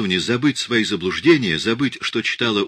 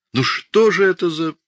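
much higher and louder whiny whimper; 11 semitones higher, 5 dB louder than usual. Acted. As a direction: whine like a sulky sick child. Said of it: "Он захныкал".

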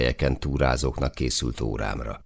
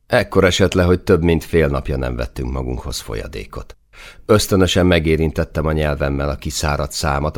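ordinary delivery

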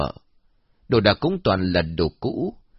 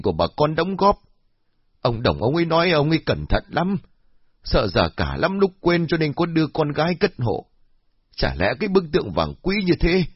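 put-on high, accusing old woman; frowning suspicion; 6 semitones higher than usual. Old woman accusing the daughter, high and suspicious. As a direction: press her with suspicion. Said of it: "cau mày".

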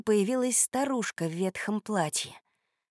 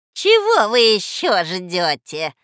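slow, loud, drawn-out question; insistent old woman; 9 dB louder, slower than usual. A narrator's reading. Old woman hurrying children to her table, insistent. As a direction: croon with pressing insistence.

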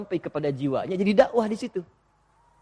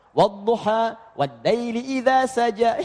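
slow chanted "wad laili idza saja"; slow Quran chant; Quran recitation; 2 semitones higher, slower than usual. Retold or imitated. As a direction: recite slowly as he did for that community. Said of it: "pernah saya bacakan Wadduha".

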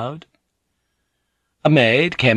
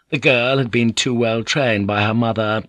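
ordinary talk, nothing marked

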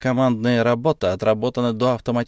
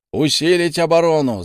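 gym coach; 5 semitones higher, 4 dB louder than usual. Acted. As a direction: coach bellowing orders. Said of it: "заорал тренер во всю глотку".

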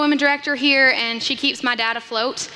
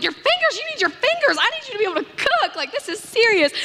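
high panicked disbelief, much higher; 9 semitones higher than usual. Retold or imitated. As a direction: squeal it in alarm. Panicked daughter.

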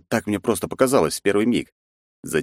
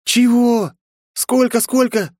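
shocked; 5 dB louder, slower than usual. Acted. Shocked by the price.

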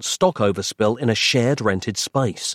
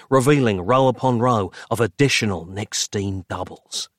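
usual speaking voice

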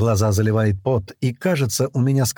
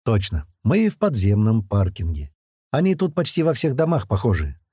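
usual speaking voice

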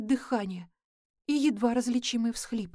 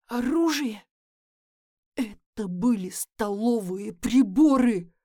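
outraged; 3 dB louder than usual. Acted. James outraged at Nick.

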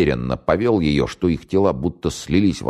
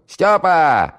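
"Стёпа!" yelled high and loud down the flat; shouting at full voice; 14 semitones higher, 6 dB louder than usual. Acted. Valiulina calling Styopa to the phone, full-throated yell. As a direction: call "Стёпа!" across the hall at the top of your lungs. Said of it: "заорала во всё горло".

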